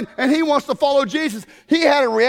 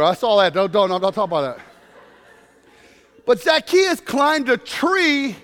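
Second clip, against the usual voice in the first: normal talking voice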